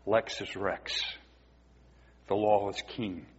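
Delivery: Lex is Rex said low and soft, reverent; soft, low, solemn declaration; hushed solemn quote. Retold or imitated. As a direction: drop the voice for the reformers' creed. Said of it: "they said".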